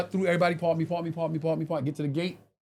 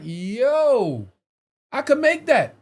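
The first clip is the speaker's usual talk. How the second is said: sudden bright idea, pitched up; excited; 6 semitones higher, 4 dB louder, much slower than usual. Retold or imitated. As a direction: call it out like a lightbulb moment.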